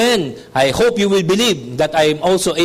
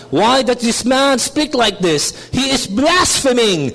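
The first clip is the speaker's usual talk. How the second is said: scribes questioning; 5 semitones higher, slower than usual. Retold or imitated. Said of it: "questioning in their hearts".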